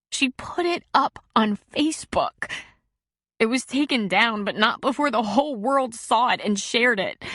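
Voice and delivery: a hint of a hurt teen pouring it out; a distraught girl describes the leaked picture to a counselor; upset teenage girl. A narrator's reading. Teen girl voiced in story.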